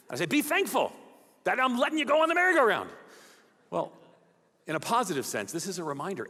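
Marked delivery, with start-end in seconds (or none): 0.3-2.9 higher, exasperated father's lecture; raised-pitch, hammed-up dad telling off his children; mock-scolding dad. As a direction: scold the kids in comic indignation.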